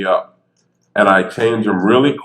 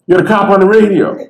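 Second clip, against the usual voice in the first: excited woman; 11 semitones higher, 5 dB louder than usual. Retold or imitated. Lady recognizing him excitedly.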